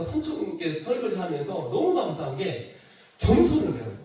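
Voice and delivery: usual speaking voice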